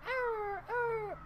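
put-on high, soft baby-like wail; imitated fox cry. Answering the co-host about fox noises, imitated cry. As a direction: softly mimic a crying fox. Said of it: "foxes sound like babies crying".

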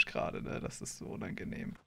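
whispering aside; whispered. Real, not acted. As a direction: whisper it off-mic. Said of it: "das ist so maximal cringe". (geflüstert)

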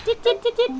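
high soft squeaks at a pet; high squeaky cooing. Real, not acted. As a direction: coo at the hamster in a tiny high voice. Cooing to hamster.

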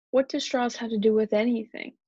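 ordinary delivery, nothing marked

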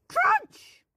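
high, loud mock crunch; loud crunch sound. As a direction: shout the crunch noise. Imitating a bite.

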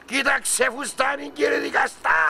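slow, drawn-out appeal; pleading in court. Pretend. Man acting out his plea to the judge, theatrical pleading. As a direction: plead theatrically, stretching the words. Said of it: "τι θα πω στο δικαστήριο".

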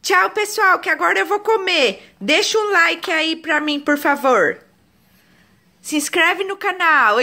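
put-on mother voices baby signing off the channel; voicing the baby. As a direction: speak for the baby in a high cute voice.

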